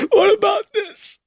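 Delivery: high, loud accusing scream; grieving mother screaming at the chief. Acted. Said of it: "Missus Kintner screamed".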